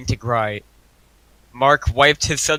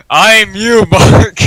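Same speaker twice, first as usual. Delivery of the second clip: louder retake of the evil line; redo of the villain; 5 semitones higher, 10 dB louder, much slower than usual. Acted. Retaking the double's line, louder mock villain. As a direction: push it out louder this time. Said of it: "He, fine".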